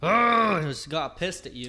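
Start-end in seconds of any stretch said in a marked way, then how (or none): 0.0-0.6 loud caveman grunt, pitched up; chest-beating grunt. Retold or imitated. Imitating an angry site guy beating his chest, loud grunt. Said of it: "he would have beat his chest".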